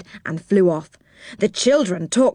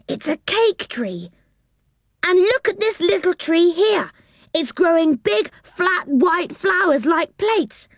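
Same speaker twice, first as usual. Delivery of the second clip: much higher, wide-eyed wonder; called out in surprise; 9 semitones higher than usual. A narrator's reading. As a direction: marvel at each new sight. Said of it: "called out in surprise".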